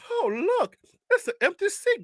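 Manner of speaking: high-pitched, delighted tourist voice; mock an innocent visitor spotting a treat; naive tourist. Guest of New York spotting an empty seat, mock delight. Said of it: "If you see everybody else over there, you're like".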